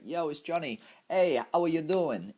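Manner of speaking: high-pitched greeting in a put-on Spanish accent. Promoter hailing the bar staff, mock Spanish accent. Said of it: "in my Spanish accent".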